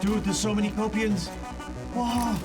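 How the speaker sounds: high-pitched real alarm at a swarm; startled excitement. Real, not acted. Gamer warning teammate of a swarm, startled and excited. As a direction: exclaim it high, caught off guard. Sudden swarm of creatures in game.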